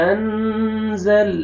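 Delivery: انزل with the nun stretched long; slow drawn recitation. Shown as a demonstration. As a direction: draw out انزل very slowly. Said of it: "آپ نے نون کو کھینچنا ہے".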